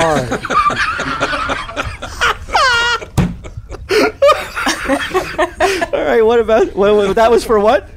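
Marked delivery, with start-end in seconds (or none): 1.5-5.9 long shrieking laugh way up high; high-pitched fit of laughter. Real, not acted. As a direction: crack up helplessly. Cohost laughing at fembot joke.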